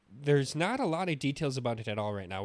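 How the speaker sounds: ordinary talk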